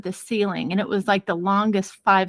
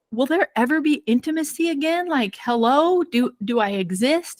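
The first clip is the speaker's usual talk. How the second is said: higher-pitched, lightly imitated pleading; mock plaintive; 5 semitones higher than usual. Neglected husband asking his wife about intimacy, mock plaintive. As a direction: imitate a neglected husband, starting high and easing down.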